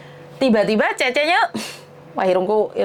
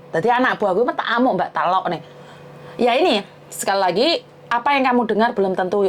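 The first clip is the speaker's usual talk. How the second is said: ordinary talk, nothing marked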